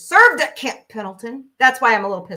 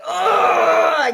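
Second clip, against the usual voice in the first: mock outraged shout; 9 semitones higher, 7 dB louder than usual. Retelling confronting the rude man.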